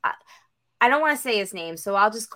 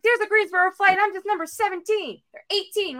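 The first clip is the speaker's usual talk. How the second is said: exasperated insistence; 11 semitones higher than usual. Retold or imitated. Daughter insists to mom the flight exists, exasperated. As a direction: argue back in a strained high pitch. Arguing back at mom's doubt.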